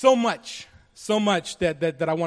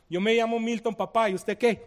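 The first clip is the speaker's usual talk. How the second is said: louder, higher, lively Colombian Spanish greeting; Colombian slang Spanish; 5 semitones higher, 6 dB louder than usual. Retold or imitated. Man greeting a nine-year-old boy at church, playful Colombian slang. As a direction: greet him in breezy Colombian street Spanish. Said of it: "spoke to him in my Colombian slang dialect".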